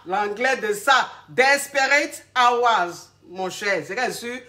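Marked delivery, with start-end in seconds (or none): 1.3-3.1 "Desperate Hours" stretched very slowly, sounded out syllable by syllable; "Desperate Hours" slowly sounded out in English, laughing at her own accent; struggling English. Shown as a demonstration. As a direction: drag out "Desperate Hours" like a hard foreign phrase. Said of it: "Laissez mon anglais".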